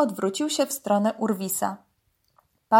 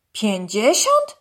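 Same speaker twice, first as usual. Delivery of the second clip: slow, incredulous rising question; astonished; 4 dB louder, slower than usual. A narrator's reading. Dog repeats the python's price, astonished. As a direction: repeat it in drawn-out disbelief. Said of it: "powtórzył zdziwiony Urwis".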